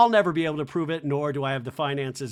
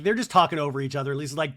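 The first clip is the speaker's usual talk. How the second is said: ordinary talk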